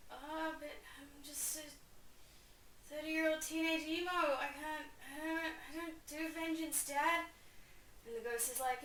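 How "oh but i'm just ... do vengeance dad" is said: put-on higher, whingeing teen; mopey excuses to Dad; whiny emo Hamlet. Hamlet whining to his father's ghost. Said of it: "And Hamlet's like".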